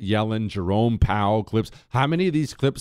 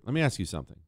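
ordinary delivery

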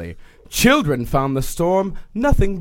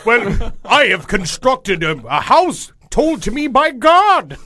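pompous voice; 5 semitones higher than usual. Retold or imitated. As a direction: boast like a pompous builder. Arrogant builder voiced.

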